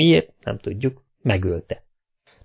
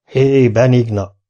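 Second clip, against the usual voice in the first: slow, louder call across the house; 2 semitones higher, 6 dB louder, slower than usual. A narrator's reading. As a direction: call her name into the empty house. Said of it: "kiáltott Kinizsi".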